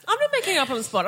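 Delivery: indignant. Real, not acted. Indignant denial.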